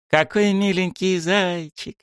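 very high, slow cooing; delighted chirp. A narrator's reading. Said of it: "восторженно прощебетала она". (восторженно прощебетала)

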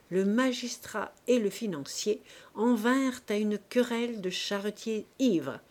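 ordinary delivery

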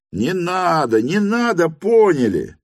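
high, emphatic replies; crowd's assent. A narrator's reading. Guests answer the vote question, high emphatic assent. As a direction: call back from the crowd, pitched high.

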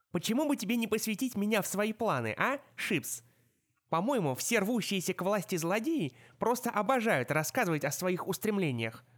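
lightly voiced sardonic centaur; ироничный кентавр. A narrator's reading. (слегка насмешливый голос кентавра)

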